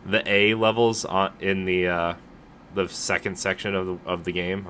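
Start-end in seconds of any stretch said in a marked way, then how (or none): none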